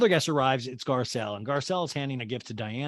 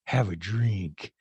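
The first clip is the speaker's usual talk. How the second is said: low, slow mock menace; weirdly threatening; 5 semitones lower, much slower than usual. Renee urges a nervous guest, weirdly threatening. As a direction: offer it like a veiled threat, drawn out low. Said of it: "It's just weirdly threatening".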